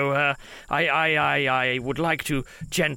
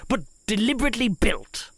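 ordinary talk, nothing marked

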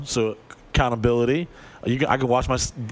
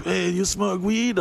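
higher, slow stoner question; imitated stoner; 6 semitones higher, slower than usual. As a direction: drag it out high, like a smoker mid-hit. Imitating smoker after a big hit.

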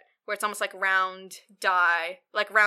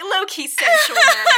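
high and loud through laughter; cracking up mid-phrase; laughing; 12 dB louder than usual. Real, not acted. Speaker laughing at the scene.

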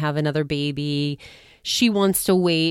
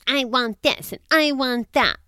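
higher, mock-spoiled demanding voice; demanding diva; 8 semitones higher than usual. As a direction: play the spoiled wife who wants everything. Imitating a demanding wife.

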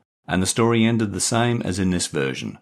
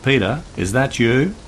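raised voice, calling through a door; 7 semitones higher, 4 dB louder than usual. Acted. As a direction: call out nervously to the door. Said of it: "called out".

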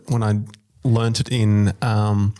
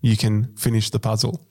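ordinary talk

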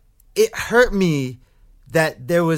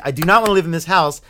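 ordinary delivery

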